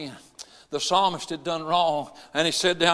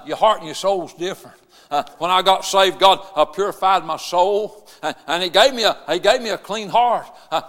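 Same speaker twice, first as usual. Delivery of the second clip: usual speaking voice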